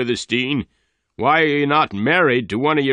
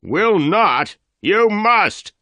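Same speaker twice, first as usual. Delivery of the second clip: much higher, loud outraged demand; shouted; 12 semitones higher, 5 dB louder, much slower than usual. A narrator's reading. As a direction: bark the order at her. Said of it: "Dyer shouted".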